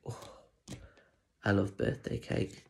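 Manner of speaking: plain reading voice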